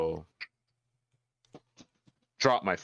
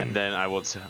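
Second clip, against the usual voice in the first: ordinary talk, nothing marked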